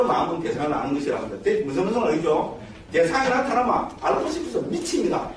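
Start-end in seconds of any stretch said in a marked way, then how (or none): 2.9-5.3 high, playful mock craving; lifted pitch, comic urgency; worked-up emphasis. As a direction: play up being crazy to know. Dramatising a mind desperate to know.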